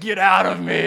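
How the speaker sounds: loud mock-frustrated growl at himself. Tired man ordering his tiredness out, mock frustration. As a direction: force it out, comically fed up. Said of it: "frustrated with my tired".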